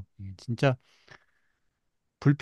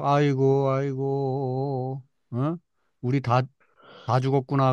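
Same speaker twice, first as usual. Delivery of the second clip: drawn-out mock lament, half-hearted; mock wailing; slower than usual. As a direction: wail like a whining crowd, lightly. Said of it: "밤새도록 통곡하".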